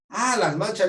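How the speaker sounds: as if the penny just dropped; sudden realization. Retold or imitated. He suddenly catches María's hint. (caer en la cuenta de golpe)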